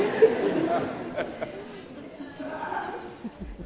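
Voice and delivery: high, breathy nervous laugh. Real, not acted. Class president laughing nervously at the podium, high. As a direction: laugh off the tears.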